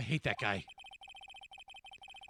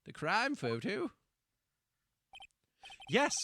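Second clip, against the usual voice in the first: normal talking voice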